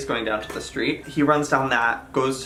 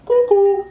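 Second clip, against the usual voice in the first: high loud cuckoo bird call; a loud high cuckoo, like a clock bird; 7 dB louder than usual. Acted. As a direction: pop out a sudden cuckoo call. Sudden bird-call noise.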